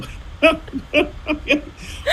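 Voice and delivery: real high-pitched burst of laughter. Real, not acted. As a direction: crack up at the punchline. Punchline lands, both collapse.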